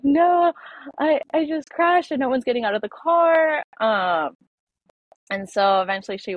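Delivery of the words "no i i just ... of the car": higher and louder, frantic and distressed; retold panic, pitched up and loud; panicked reply. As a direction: blurt it out in a panic after the crash. Retelling her own panicked reply.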